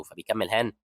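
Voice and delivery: usual speaking voice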